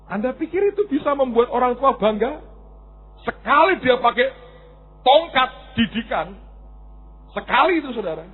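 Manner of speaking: ordinary talk, nothing marked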